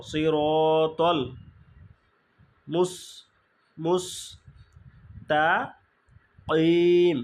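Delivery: সিরতল Mustakim recited slowly with the heavy ত; slow recitation. Shown as a demonstration. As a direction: draw it out slowly. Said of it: "তাহলে এখানে ত জবর ত হবে".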